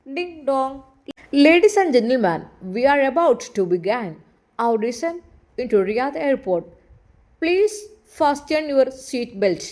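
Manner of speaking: slow, measured airline announcer; flight announcement. Acted. Flight attendant announcing descent to passengers, slow announcer voice. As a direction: read it like the cabin speaker. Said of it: "വിമാനത്തിൻ്റെ announcement".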